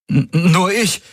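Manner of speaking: high, stuttered admission; stammering. A narrator's reading. Man answering the guard leader, stammering. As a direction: catch on the first sound, pitched high. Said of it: "stammelte Valyr".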